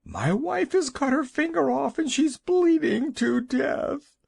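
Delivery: very high, frantic, slowing at the end; panicked husband. A narrator's reading. Husband frantically tells the Wizard his wife is dying. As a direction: blurt it out in terror.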